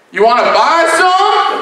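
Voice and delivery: high, slow, climbing question; shady fisherman. Retold or imitated. Man fishing offers weed to a kid, high drawl.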